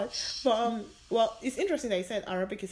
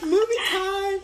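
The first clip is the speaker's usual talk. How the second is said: high, loud mock announcement; excited roommate imitation; 11 semitones higher, 9 dB louder, slower than usual. Roommate's eager announcement, retold.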